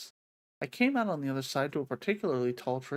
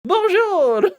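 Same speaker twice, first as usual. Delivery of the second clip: much higher and much louder, a shrill little bird cry; squeaky bird bonjour; 15 dB louder than usual. Acted. The French bird answers Alice, shrill and high. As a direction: chirp it back like a tiny excited French bird. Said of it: "like a French bird".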